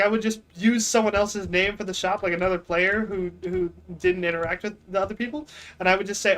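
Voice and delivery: ordinary talk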